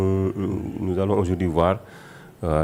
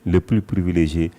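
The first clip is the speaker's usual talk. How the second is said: loud, raised declamatory verse reading; poem recitation; 3 semitones higher, 8 dB louder than usual. A narrator's reading. Guest reciting the Cheikh's praise poem for listeners, declaimed. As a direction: proclaim each line of devotion with force, pausing between phrases.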